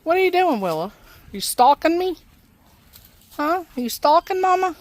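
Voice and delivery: high, sing-song questioning of a pet; cooing, mock-suspicious questions; playful pet talk. Real, not acted. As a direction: tease the animal in a high voice. Teasing questions to her animal.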